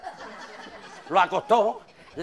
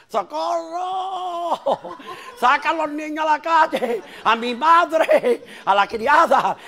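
wife screaming; 4 semitones higher, much slower than usual. Acted. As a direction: scream for help in horror. (la mujer gritando socorro)

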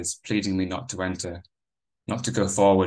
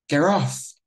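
put-on boy shouting as his hair is pulled; yelling; 8 semitones higher, 5 dB louder than usual. A narrator's reading. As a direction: cry out high and loud in pain. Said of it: "I yelled out".

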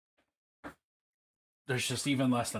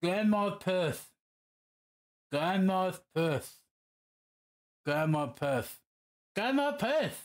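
holding own tongue; 3 semitones higher than usual. Shown as a demonstration. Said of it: "Say that while holding your tongue".

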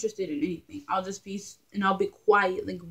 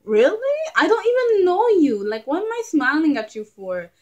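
high, loud disbelieving rant; indignant outburst; 7 semitones higher, 8 dB louder than usual. Retold or imitated. To a stranger telling her to smile, loud high disbelief. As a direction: throw it back at the stranger, outraged.

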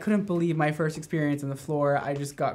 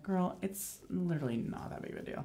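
dismissive retort; 10 dB quieter than usual. Retold or imitated. Stylist's retort about fresh color, quietly dismissive. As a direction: imitate quietly brushing someone off.

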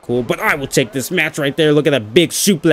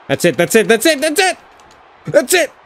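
rising pitch with each repeat; building excitement; 7 semitones higher than usual. Real, not acted. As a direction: get more hyped on every repeat.

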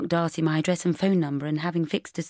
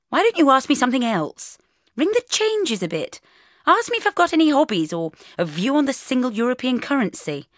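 higher, louder, exasperated teasing; exasperated mock suggestion; 8 semitones higher, 5 dB louder than usual. Bridget teasing Mark about his dull question, exasperated and higher. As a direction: rattle it off to tease him.

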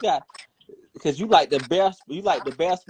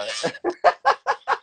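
high laugh. Real, not acted. Laughing at own joke.

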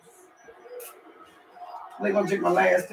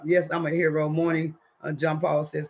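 normal talking voice

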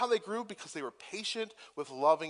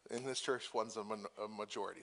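hushed whisper, half joking; whispered aside; 5 semitones lower, 8 dB quieter than usual. Real, not acted. Pastor joking to the congregation, whispered aside. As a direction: lean in and whisper it like a secret.

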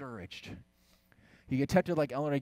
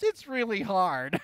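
near tears; 8 semitones higher, 6 dB louder, slower than usual. Retold or imitated. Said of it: "almost breaks out in tears".

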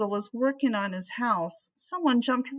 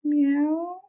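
gentle rising meow, high like a cat; mild, tender cat meow; soft meow; 7 semitones higher, 3 dB louder than usual. Acted. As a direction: coax it out like a fond cat. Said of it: "said the cat softly".

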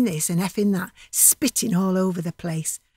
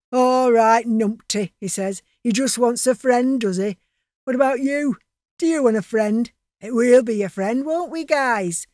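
louder mocking bully; sneering thug; 3 semitones higher, 5 dB louder than usual. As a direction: taunt him with fake friendliness.

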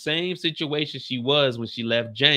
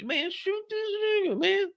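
mock exasperated; 5 dB quieter than usual. High exasperated imitated plea.

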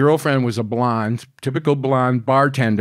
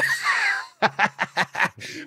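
high cackling laugh; 4 dB quieter than usual. Real, not acted. Storyteller laughing at his own rollover tale, high cackle. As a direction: burst of high-pitched laughter.

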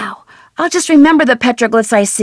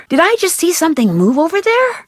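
ordinary talk, nothing marked

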